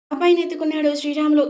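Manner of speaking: plain reading voice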